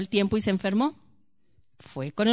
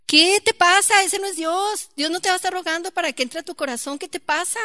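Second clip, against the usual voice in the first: indignant outburst; 8 semitones higher, 4 dB louder than usual. Real, not acted. Rebukes the weepy God image.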